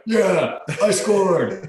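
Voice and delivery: high, loud excited cheer. Retold or imitated. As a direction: celebrate like you just scored. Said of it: "I'm like".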